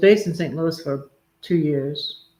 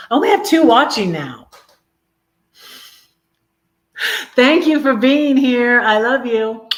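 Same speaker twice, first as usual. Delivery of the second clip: higher and louder, delighted welcome; light up as viewers arrive; excited greeting; 8 semitones higher, 10 dB louder than usual. Real, not acted. Streamer thanking her few live viewers, bright and eager.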